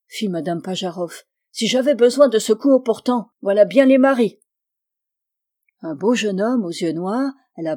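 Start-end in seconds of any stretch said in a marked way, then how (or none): 1.5-4.4 higher, louder mock indignation; exasperated complaint. A narrator's reading. Wife whose husband dozed off, indignant complaint. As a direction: complain loudly about useless husbands.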